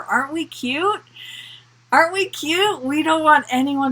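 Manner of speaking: high-pitched teasing sweetness; mock cooing. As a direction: coo it as if fussing over something adorable. Teasing coo at everyone's good intentions.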